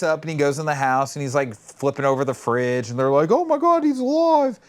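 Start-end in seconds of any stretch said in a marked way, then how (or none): 3.3-4.6 high, loud hammed-up alarm; mock shock. Family sees Bigfoot wake up in the house, mock shocked yell. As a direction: cry out in comic panic.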